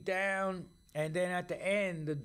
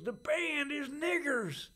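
defiant retort; 9 semitones higher, slower than usual. Retold or imitated. Doc answering the biker.

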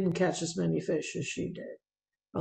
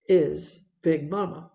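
ordinary talk, nothing marked

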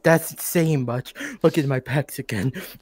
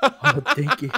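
laughing thanks; 7 semitones higher, 3 dB quieter than usual. Real, not acted.

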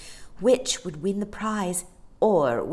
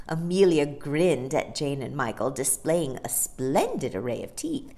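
usual speaking voice

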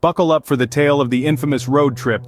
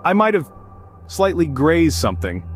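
higher, hesitant nervous confession; nervous stammer; 6 semitones higher than usual. Retold or imitated. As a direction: stammer it out, guilty teen. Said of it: "I stammered".

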